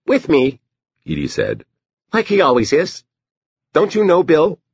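put-on very high little-girl voice; Edie, insistent child. Daughter answers the doctor about Bill, high child voice. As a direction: pitch it way up like a small child.

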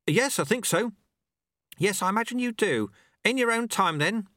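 high-pitched calling exchange; character dialogue. Acted. Apprentice answering, sweeper giving leave, calling. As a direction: answer across the hall.

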